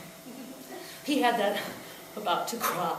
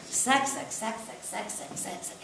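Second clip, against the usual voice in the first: fading out; 9 dB quieter than usual. Acted. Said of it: "fading repetition".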